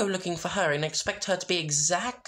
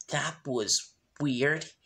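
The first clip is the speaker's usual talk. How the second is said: puzzled Sunset; much slower than usual. Acted. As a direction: mutter it half to yourself. Sunset voiced in fic reading.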